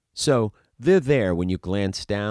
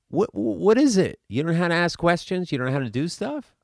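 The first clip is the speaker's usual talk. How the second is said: incredulous; 9 semitones higher, 3 dB louder than usual. Real, not acted. Coach ridiculing actors' habits.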